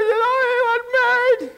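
high tearful mock whine; mock crying. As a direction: keep up the blubbering imitation. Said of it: "And he starts crying".

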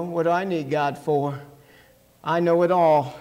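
ordinary talk, nothing marked

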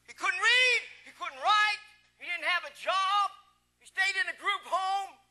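loud, high, rising list; impassioned preacher at full volume; emphatic preaching. Real, not acted. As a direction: belt out each point to the congregation. Preacher drives list home.

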